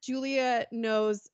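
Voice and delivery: usual speaking voice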